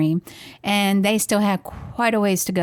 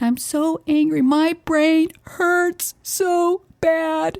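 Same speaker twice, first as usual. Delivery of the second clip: put-on high, drawn-out kid's wail; slow, high-pitched mock whine of misery; imitating upset child; 9 semitones higher, much slower than usual. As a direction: stretch it out high like a distressed little boy. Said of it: "he was upset".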